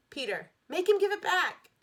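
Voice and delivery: put-on high, loud pleading girl; upset girl begging a friend; desperate plea. A narrator's reading. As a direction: beg for help near tears. Sheila pleading, near tears.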